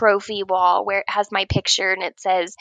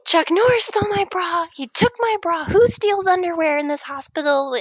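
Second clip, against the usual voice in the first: high-pitched mock outraged hollering; retold yelling; 7 semitones higher than usual. Patient accusing into empty hospital hallways, mock yelling. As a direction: imitate calling an accusation down a hallway. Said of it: "And I'm yelling it".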